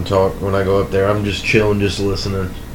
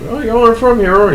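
high mocking local voice; 13 semitones higher than usual. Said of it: "be like".

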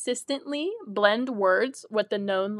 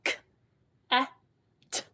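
each letter sound apart, with pauses; sounding out words; 3 semitones higher, faster than usual. Shown as a demonstration. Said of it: "So together you will say".

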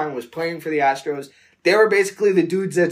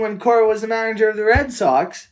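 high, laughing exasperation; laughing while talking; 7 semitones higher than usual. Real, not acted. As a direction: giggle through the story. Laughing through outraged retelling.

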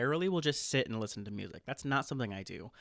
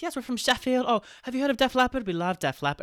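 high-pitched put-on English accent; chatty, badly faked British voice; awful fake British accent; 10 semitones higher, 5 dB louder than usual. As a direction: ham up a bad British accent. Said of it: "faking awful British accents".

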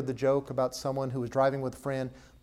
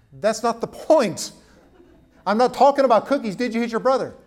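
stern parent scolding; 8 semitones higher, 10 dB louder than usual. Retold or imitated. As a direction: scold the child like a firm parent.

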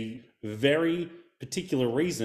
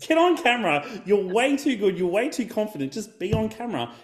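higher, louder, insisting they get on camera; cheerleading a hesitant friend with a mock push; pushy encouragement; 7 semitones higher, 4 dB louder than usual. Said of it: "He's just like".

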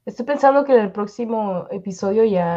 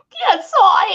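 high, loud laughing witch voice; witch's changed voice; 11 semitones higher, 5 dB louder than usual. Retold or imitated. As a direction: squeak out the witch's new voice with a laugh. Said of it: "tu bruja empezó con otro, con otra voz".

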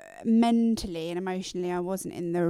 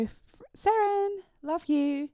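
slow sing-song, much higher; sing-song call; 10 semitones higher, slower than usual. Retold or imitated. Mum calls her daughter, sing-song and high. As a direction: call to your daughter in a lilting voice. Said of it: "I'll go".

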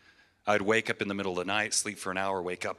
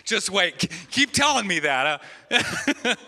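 high, loud laughing banter; 10 semitones higher, 6 dB louder than usual. Real, not acted. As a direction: laugh it off at the crowd.

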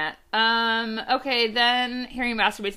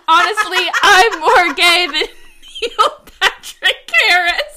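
high, loud, breaking into laughter; hammed-up, laughing outburst; emphatic declaration; 7 semitones higher, 10 dB louder, slower than usual. A narrator's reading. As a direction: proclaim it grandly and crack up. Outburst in story, cracking up.

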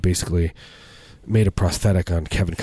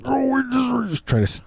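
put-on squeaky baby voice.

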